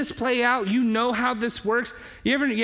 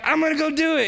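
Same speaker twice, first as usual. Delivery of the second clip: eager friend; 9 semitones higher, 4 dB louder, faster than usual. Retold or imitated. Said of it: "So they're like".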